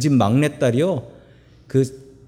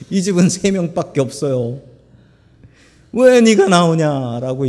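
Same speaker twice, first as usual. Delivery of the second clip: mock Jephthah complaining; 3 dB louder than usual. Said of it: "얘기를 하는 거예요".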